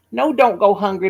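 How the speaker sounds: usual speaking voice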